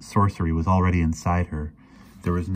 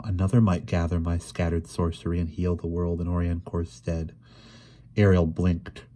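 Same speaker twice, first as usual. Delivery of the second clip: ordinary delivery, nothing marked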